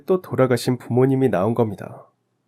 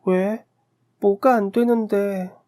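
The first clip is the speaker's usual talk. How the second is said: put-on high, slow little-girl voice, pleading; a small child crying out to her parents; child's voice; 10 semitones higher, slower than usual. Dream, narrator as little child. (높고 느린 어린아이 목소리)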